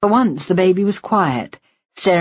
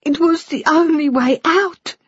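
put-on higher, tearful older woman pleading; Missus Shinwell voice; 13 semitones higher than usual. Woman confessing to the nurse, high and tearful. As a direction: voice her high and aching with guilt.

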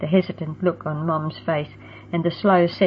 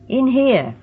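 sharp, impatient call, louder and higher; impatient order; 7 semitones higher, 4 dB louder than usual. A narrator's reading. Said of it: "an impatient voice ordered".

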